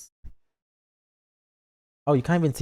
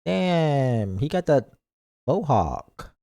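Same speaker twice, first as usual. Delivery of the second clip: usual speaking voice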